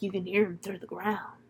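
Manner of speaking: very soft, conspiratorial murmur; hushed secrecy. Retold or imitated. Ezra tells Ward what he hears, acted hushed voice. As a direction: keep it down as if listening.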